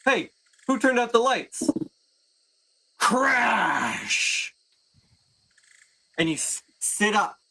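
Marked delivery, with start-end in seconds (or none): 0.0-1.7 higher-pitched guard calling out; a guard shouting into the dark; startled guard. Acted. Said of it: "hear one of the guards say". 3.0-4.5 crash sound effect. Acted. GM voices a loud crash.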